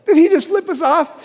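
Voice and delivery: high, loud incredulous kid impression; mock outraged squeal of a question; Bear. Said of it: "and Bear goes".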